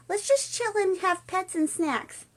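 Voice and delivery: very high, slow cartoonish voice; good friend voice. Good friend suggesting pets and snacks, high put-on voice.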